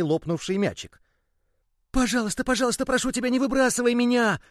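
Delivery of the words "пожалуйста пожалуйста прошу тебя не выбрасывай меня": high, loud begging little ball; loud pleading cry. Acted. The ball pleads loudly with the boy not to throw it away. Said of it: "громко закричал мячик".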